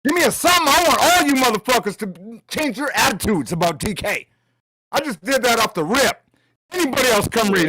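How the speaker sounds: high, hyped-up bragging; excited. Real, not acted. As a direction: crow over everyone. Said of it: "You don't have to be so loud".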